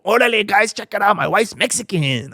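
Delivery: high, hammed-up Mexican-American accent.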